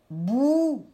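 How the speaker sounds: "bouton" with a long "bou", then "ton"; syllable-by-syllable "bouton"; split into syllables. Shown as a demonstration. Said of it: "je vais prendre la deuxième syllabe".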